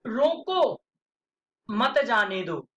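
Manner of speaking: "रोको" set apart, then "मत जाने दो" falling; pause after रोको. Shown as a demonstration. Teacher repeating first sentence to students, pause after "रोको". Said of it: "किसी को आदेश दिया जा रहा है".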